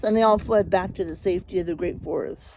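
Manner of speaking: plain reading voice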